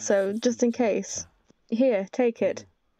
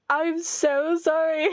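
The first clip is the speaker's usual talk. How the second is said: slow, higher, half-laughing half-crying; whiny apology; 4 semitones higher, slower than usual. Real, not acted. Player apologising to the character she just insulted, whiny apology. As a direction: draw out a whimpered apology.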